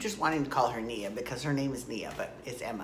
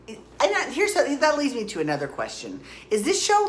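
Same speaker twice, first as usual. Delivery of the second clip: incredulous squeak; 10 semitones higher, 4 dB louder than usual. Real, not acted. Incredulous rising question.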